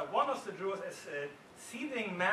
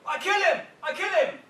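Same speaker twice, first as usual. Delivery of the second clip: high, loud, lunging scream; shouting; 12 semitones higher, 13 dB louder than usual. Retold or imitated. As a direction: scream it, held back by the others. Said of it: "juror number three shouts".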